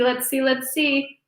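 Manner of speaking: ordinary talk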